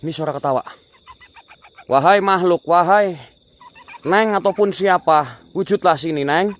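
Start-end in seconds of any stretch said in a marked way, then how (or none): 1.9-6.5 high, raised call; commanding summons. Real, not acted. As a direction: call the spirit out into the open. Summons the spirit. (memanggil lantang)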